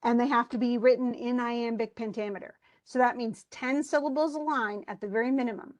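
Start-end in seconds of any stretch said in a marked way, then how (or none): none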